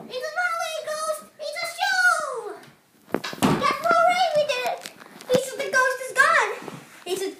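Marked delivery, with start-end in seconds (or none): 0.4-2.2 high, excited play shout; much higher, thrilled game call. Pretend. Chasing the pretend ghost away. 3.9-4.8 high, loud victory cheer. Pretend. Celebrating the win in the game. 5.6-6.5 loud, high victory call; excited, raised voice; triumphant announcement. Pretend. Announcing victory over the ghost.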